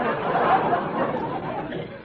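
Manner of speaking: high uneasy giggle; nervous laugh. Acted. Saleswoman giggles nervously at the murderer. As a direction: giggle to cover the panic.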